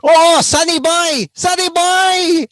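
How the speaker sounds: put-on high, loud, drawn-out Filipino-accented call; imitating Filipino father hailing his son; dad's Filipino accent. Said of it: "that accent".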